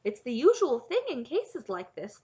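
plain reading voice

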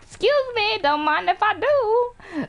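high, drawn-out singsong boast; playful gloating. Pretend. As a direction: glide past with slow, high mock politeness. Gleefully slipping past other racers.

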